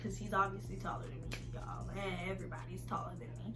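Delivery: fast, quick whisper; whispered aside. Real, not acted. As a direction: toss it off in a whisper.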